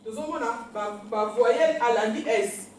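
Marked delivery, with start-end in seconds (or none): 1.1-2.7 voyelle said slowly and louder; slow, careful explanation. Shown as a demonstration. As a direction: spell it out for the class.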